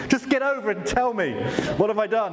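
half-mocking his own insecure impatience, rushing her on; impatient inner voice.

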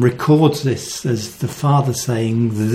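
usual speaking voice

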